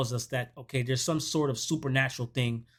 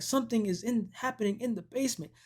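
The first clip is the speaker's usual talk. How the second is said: high, softer panicky babble; hammed-up panic, pitched up without shouting; mock frantic; 7 semitones higher, 4 dB quieter than usual. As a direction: imitate her frantic report. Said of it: "She's frantic".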